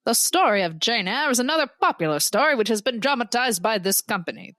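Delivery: vintage newsreel announcer reading a film review; old-timey newsman voice, emphatic and formal. Acted. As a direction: declaim it like an old-time newsman. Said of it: "your old-timey newsman voice".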